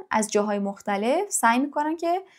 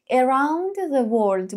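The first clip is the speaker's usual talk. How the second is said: around the world said very slowly; drawn-out around the world; slow model reading; much slower than usual. Shown as a demonstration. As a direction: spell it out slowly for learners. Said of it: "teacher models".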